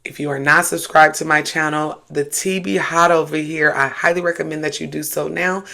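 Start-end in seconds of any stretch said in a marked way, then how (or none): none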